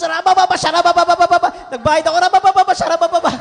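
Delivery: loud, high babbling in tongues with laughter. Retold or imitated. Paying the fare while shouting in tongues, laughing. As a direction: keep bursting into joyful tongues. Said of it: "nag-speaking in tongues po ako".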